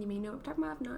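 ordinary talk

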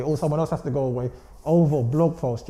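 usual speaking voice

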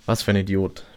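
ordinary delivery, nothing marked

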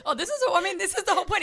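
laughing, high and bright. Real, not acted. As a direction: laugh through the words. Laughing reply to podcast remark.

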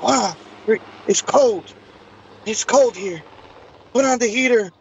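high-pitched imitation of chilly visitors; retold exclamations at the cold, high; complaining of cold.

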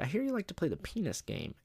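high, swooping repeat of an accusation; teasing principal, pitch jumping up; mock-interrogating principal. Principal repeats his odd accusation.

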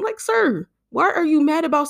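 sharp high call-out; calling out a stranger, fed up; exasperated sir. Acted. Scolding an angry commenter, exasperated.

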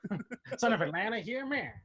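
mock Mid-Atlantic accent. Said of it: "the Mid-Atlantic accent".

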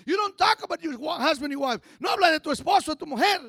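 loud, driving pulpit delivery; preaching at full force. Acted. Preacher warning the church about gossip, at full force. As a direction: preach it hard to the congregation.

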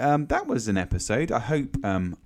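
normal talking voice

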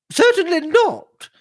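very high-pitched, sharp denial. A narrator's reading. Aunt rebuffs Cynthia's question, high and sharp. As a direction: cut them off sharply in a high voice. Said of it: "said Missus Singlethorpe sharply".

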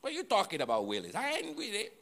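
put-on high, childish denial; preacher mimicking Peter as a petulant kid, pitched high. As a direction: play a defensive nine-year-old brushing it off. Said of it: "a little nine-year-old kid who just said".

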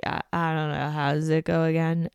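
imitating someone too high to remember; spaced-out mumble. Courtney fumbling the prayer, mock spaced out. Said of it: "She definitely seems high".

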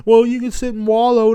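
high, mocking lilt, a sneer at the advice; mocking sing-song. Mocking someone who says you can wallow, high and scornful.

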